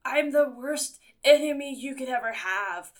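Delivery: a hammed-up predator threat; dinosaur voice. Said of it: "he's like".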